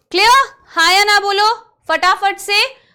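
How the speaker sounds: urgent classroom prompt; loud call to class. Real, not acted. Teacher prompting students to answer.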